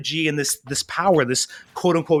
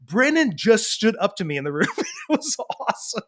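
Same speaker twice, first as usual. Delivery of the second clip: raised voice breaking into laughter; proud announcement; 6 semitones higher than usual. Retold or imitated. Dad telling family about son, proud and laughing. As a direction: retell a dad's delighted boast.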